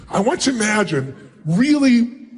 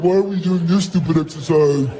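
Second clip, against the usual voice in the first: lower, grumbling adult voice; bored adult; 5 semitones lower than usual. Retold or imitated. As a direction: sound like a grown-up who resents this. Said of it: "If you do it like an adult".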